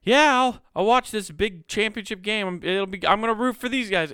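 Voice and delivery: hammed-up fake excitement for a buddy's team; forced high-pitched fan voice; half-hearted fake enthusiasm. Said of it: "You're just like".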